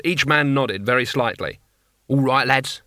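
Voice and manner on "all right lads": plain reading voice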